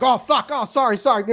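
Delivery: high, loud flustered apology as he barrels past; startled apology. Acted. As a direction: fake sorry while shoving by. GM voices Sean bumping into someone.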